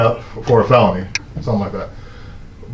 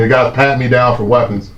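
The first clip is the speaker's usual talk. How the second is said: ordinary talk, nothing marked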